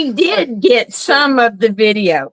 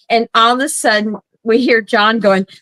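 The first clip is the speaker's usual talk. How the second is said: ordinary delivery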